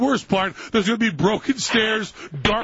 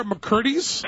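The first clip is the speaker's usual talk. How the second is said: usual speaking voice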